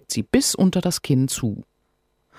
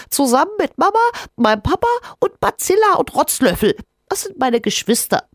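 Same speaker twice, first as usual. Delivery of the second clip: cheeky little creature; 5 dB louder, faster than usual. Acted. Rinus listing family.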